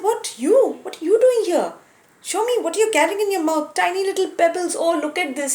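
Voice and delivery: high-pitched mock disbelief; incredulous scoffing. A narrator's reading. Big monkey to squirrel carrying pebbles, high and scornfully incredulous. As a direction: question the little helper in high, amazed derision.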